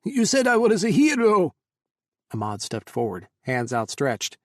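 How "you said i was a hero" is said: high, loud distressed accusation; upset accusation. A narrator's reading. Hamid confronting the men who promised him safety, loud and upset. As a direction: cry out feeling betrayed.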